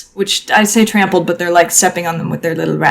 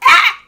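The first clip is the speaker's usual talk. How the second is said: rat shriek; 12 semitones higher, 4 dB louder than usual. Acted. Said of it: "it goes".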